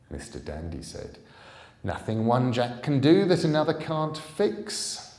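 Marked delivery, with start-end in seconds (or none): 1.8-5.1 louder and higher, grandly confident; boastful declaration. Acted. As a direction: announce it like a proud magician. Dandy boasting, voice raised.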